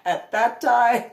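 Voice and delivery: high helpless laughter; real laughter remembering her puppy; laughing. Real, not acted. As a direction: break up laughing mid-phrase. Can't stop laughing at memory.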